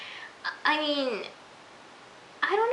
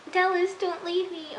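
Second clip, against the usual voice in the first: mock plea in a raised pitch; hammed-up, higher begging; exaggerated pleading; 7 semitones higher than usual. Fan overplaying Allie begging Dallas to stay, mock pleading. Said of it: "Okay, she wasn't like that".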